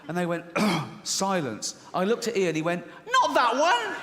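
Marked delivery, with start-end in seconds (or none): 3.1-4.0 very high, loud strangled cry; appalled mock shriek. Friend stopping him after the wrong joke, appalled shriek. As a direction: squeal it in comic panic.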